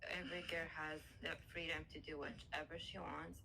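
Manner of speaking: normal talking voice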